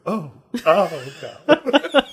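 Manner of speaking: drawn-out mock-horrified moan, high and loud; hammed-up dread, slow and wailing; mom's spooked cry. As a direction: imitate a creeped-out mom overheard in a cinema. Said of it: "you'd just hear her go".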